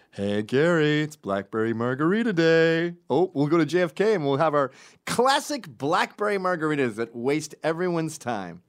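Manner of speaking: loud, high sing-out mockery; hammed-up gleeful buddy voice; mocking the friends. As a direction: ridicule them with fake excitement. Mocking the friends' drink tradition.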